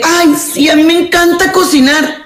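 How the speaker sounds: hammed-up eager-to-please girl; mock sweet girl. Mocking a sweet-talking woman.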